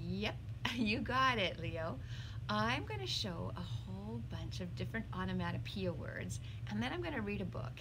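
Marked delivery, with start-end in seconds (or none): none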